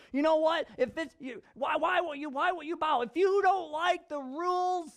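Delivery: mock-furious official ordering them out; angry Haman. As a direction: bark it at the ones who won't bow. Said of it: "Haman gets angry, and he says".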